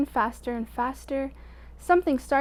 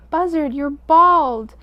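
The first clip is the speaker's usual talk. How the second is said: loud, slow stunned exclamation; loud exclaim; 4 semitones higher, 10 dB louder, slower than usual. A narrator's reading. Wolf gaping at bald Buzzard, loud and slow. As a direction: bellow it out slowly.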